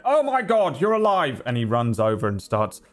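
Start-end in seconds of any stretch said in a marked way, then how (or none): 0.1-1.4 high, startled exclamation; astonished relief. Acted. Poncho man finding the child alive, high and astonished. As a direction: cry out in disbelief and relief.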